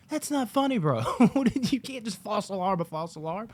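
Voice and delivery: high-pitched mock outrage; indignant protest. Moviegoer scolding laughing theater worker, mock indignation.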